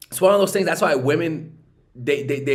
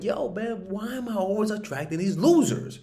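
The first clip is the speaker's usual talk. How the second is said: higher, exasperated woman's voice; 7 semitones higher than usual. Retold or imitated. Woman fed up with attracting losers, higher exasperated voice. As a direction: sigh it out as a tired woman. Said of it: "deep down they're like".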